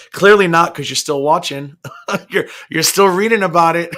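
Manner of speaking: mocking callout. Mocking a quitter who still watches, loud laughing retort. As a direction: laugh while you mock them. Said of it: "I'm like".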